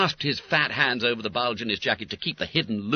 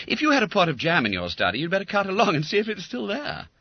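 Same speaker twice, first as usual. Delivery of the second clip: schoolboy voice; 12 semitones higher, 3 dB louder, faster than usual. A narrator's reading. Bob voice.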